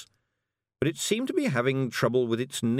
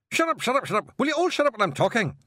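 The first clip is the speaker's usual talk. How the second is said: high, loud, rapid-fire Scottish scolding; fed-up little Scotsman shouting; exasperated Scottish yelling; 4 dB louder, faster than usual. Acted. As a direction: bellow for silence in a high Scots voice. Bearded man yells at unruly companions.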